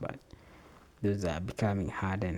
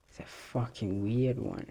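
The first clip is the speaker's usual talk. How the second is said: ordinary delivery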